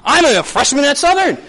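high, gushing student excitement; hammed-up eager newcomer; excited freshman. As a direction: burst out with big plans. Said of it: "you're like".

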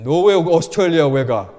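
loud, very fast scolding Korean dad; imitating Korean father. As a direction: fire the question like an angry father.

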